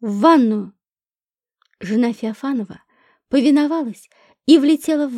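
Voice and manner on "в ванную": low, forceful command; barked order. A narrator's reading. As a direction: bark it out low and firm. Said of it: "рявкнула я". (рявкнуть приказ)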